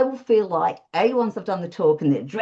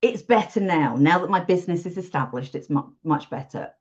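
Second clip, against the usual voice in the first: ordinary talk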